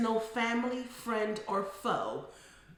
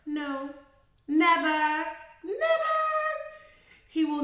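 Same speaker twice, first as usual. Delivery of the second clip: loud, very high drawn-out refusal; emphatic denial; 10 semitones higher, 7 dB louder than usual. Real, not acted. Therapist doubting the boyfriend would back her, soaring loud denial. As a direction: let it soar up in total disbelief. Emphatic answer to own question.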